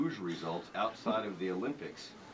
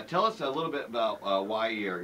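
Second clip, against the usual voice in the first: ordinary talk, nothing marked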